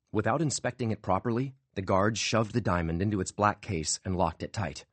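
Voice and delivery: ordinary delivery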